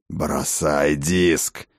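low, very slow, forceful order; angry command. Acted. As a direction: drag out the order, seething. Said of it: "яростно зарычал отец дружин".

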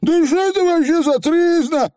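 высокий возмущённый голос фигля; возмутись высоко, с пренебрежением; презрительно фыркает. A narrator's reading. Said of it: "фыркнул Явор заядло". (презрительное фырканье)

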